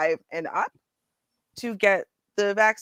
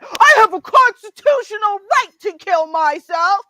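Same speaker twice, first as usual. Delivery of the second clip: high, loud mock protest; mocking imitation; 12 semitones higher, 9 dB louder than usual. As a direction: parody an indignant rights-claimer at full pitch. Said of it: "No one else does that".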